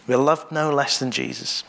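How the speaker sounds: ordinary talk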